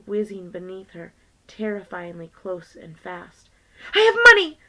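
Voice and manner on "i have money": desperate scream, very high and very loud. Acted. Violet screams to stop the man throwing Myrtle, piercing scream. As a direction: shriek it to stop him. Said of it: "Violet screamed".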